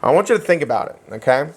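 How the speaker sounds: ordinary talk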